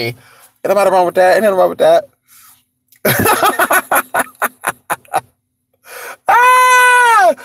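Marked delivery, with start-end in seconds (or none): 3.0-5.2 long high cackling laughter; real laugh. Real, not acted. Laughing at their own auntie joke, real cackle. 6.3-7.3 loud, very high held scream; loud shriek. Real, not acted. Shrieking after their own joke, loud and high.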